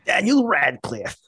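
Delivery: slow put-on gravelly hero voice; jokey action hero impression; mock Wolverine growl. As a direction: deliver the name like a movie trailer tough guy. Joking Wolverine voice bit.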